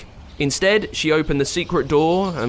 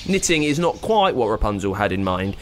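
plain reading voice

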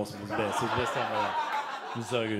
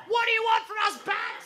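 very high cry up at the sky; desperate cry. Retold or imitated. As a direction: plead with the bat in a hammed-up high wail. Calling up at the circling bat.

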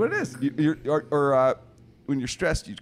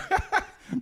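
breathless airless laugh, very high; silent wheeze laugh. Real, not acted. Joking about people making him cry, wheezing laugh. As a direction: let out a silent squeaky wheeze.

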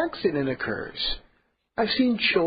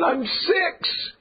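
exasperated; 9 semitones higher than usual. Retold or imitated. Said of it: "with exasperation".

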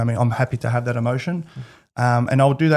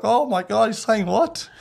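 mock shock; 9 semitones higher, slower than usual. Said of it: "I was like".

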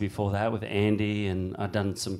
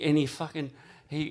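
ordinary talk

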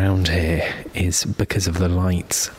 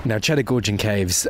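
ordinary talk, nothing marked

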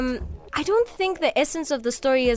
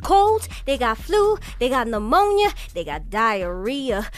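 put-on Southern accent, rising higher; acting Celie in a Southern accent; 4 semitones higher than usual. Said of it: "something off the actual script".

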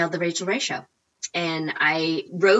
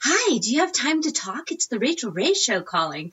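higher, lilting sing-song caller imitation; Mary Poppins sing-song; 6 semitones higher than usual. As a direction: lightly mimic a chirpy nanny on the phone. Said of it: "Mary Poppins sing-songy voice".

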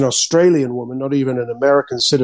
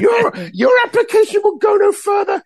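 mock shrieky Australian accent, pitched way up; high-pitched Australian accent. Frustrated museum director rejecting an artist, high-pitched Australian accent. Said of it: "in her high-pitched Australian accent".

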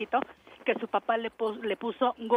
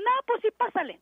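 much higher, casual imitation; easygoing wave-through; 12 semitones higher, 3 dB louder than usual. Hospital staff letting the patient through, retold casual assent.

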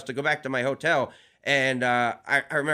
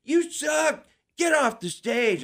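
high, slower mock yell, the heckler again; retold heckler yell; 10 semitones higher, slower than usual. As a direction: throw the heckle at the stage a second time. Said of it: "but then he yelled".

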